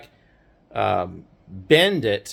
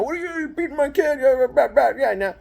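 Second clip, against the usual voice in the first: upset parents; 10 semitones higher than usual. Retold or imitated. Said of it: "they're all upset".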